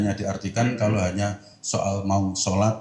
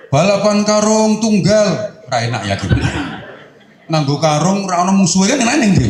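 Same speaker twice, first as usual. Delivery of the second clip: high, loud, laughing tease; excited mockery; 9 semitones higher, 8 dB louder than usual. Preacher mocking a sack race with one runner, laughing. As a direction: hype up a race with no rivals.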